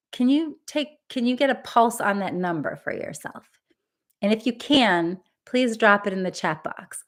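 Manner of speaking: ordinary delivery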